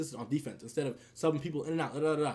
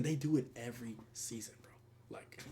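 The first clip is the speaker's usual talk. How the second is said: whispered aside, much softer; 6 semitones lower, 11 dB quieter than usual. Real, not acted. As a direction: slip it out as a secret. Drops voice to aside.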